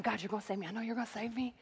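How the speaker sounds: whisper-close prayer to God; hushed prayer. Retold or imitated. Man in the flood praying to himself, hushed.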